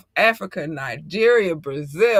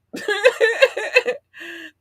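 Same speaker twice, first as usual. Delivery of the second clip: high laugh. Real, not acted.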